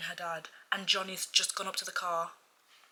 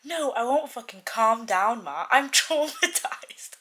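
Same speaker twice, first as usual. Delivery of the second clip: put-on loud, higher, outraged young man; outraged teen; 4 semitones higher, 9 dB louder than usual. Johnny yelling at his parents, outraged and loud. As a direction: shout it like a mortified son. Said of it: "Shuddering, he ran a hand".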